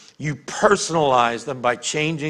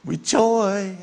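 strained mock joy; 4 semitones higher than usual. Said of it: "you're gonna go".

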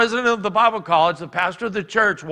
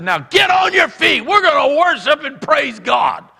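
loud, high commanding call; rallying shout; 9 semitones higher, 7 dB louder than usual. Retold or imitated. Preacher rallying the congregation back to worship, shouting. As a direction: rouse the whole congregation to its feet.

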